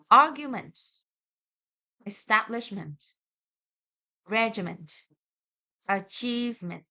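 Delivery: argument, establishment, ment kept weak; teacher reads word list, ment light; no stress on ment. Shown as a demonstration. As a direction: keep ment unstressed in each word. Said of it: "do not put the stress on ment".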